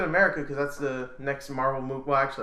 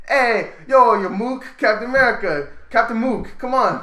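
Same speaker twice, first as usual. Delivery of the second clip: higher, louder mock New York Italian, laughing; Italian accent; 9 semitones higher, 8 dB louder than usual. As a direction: play a street tough mocking Captain. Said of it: "Sounds foreign".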